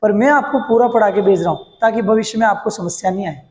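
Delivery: normal talking voice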